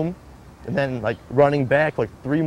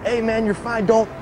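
much higher, pleading voice of the officer; urgent stranger's plea; 9 semitones higher than usual. Retold or imitated. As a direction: beg him to keep his eyes open, slowing on don't close your eyes. Officer urging bleeding kid stay awake.

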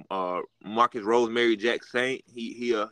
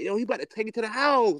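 retold hype, much higher; amazed reaction; 12 semitones higher than usual. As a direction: relive the thrill of seeing the first catch. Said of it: "I'm like".

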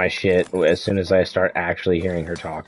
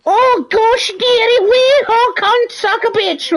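mocking her fake accent, very high, loud and slow; shrill mock of a fake Scottish-ish mum accent, much higher and louder; 5 dB louder, slower than usual. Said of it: "With her fake fucking accent".

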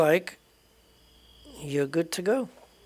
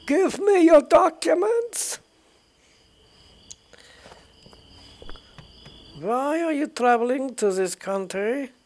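much higher, louder mock Russian guard bark; attempted Russian accent; 11 semitones higher, 6 dB louder than usual. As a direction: bark for papers like a hammed-up Russian border guard. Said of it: "I can't do a Russian accent".

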